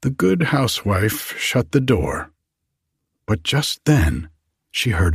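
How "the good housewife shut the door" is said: ordinary delivery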